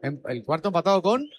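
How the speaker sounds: talking around a pen; words blurred by a pen in mouth; pen in teeth. Real, not acted. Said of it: "Sácate el boli de la boca".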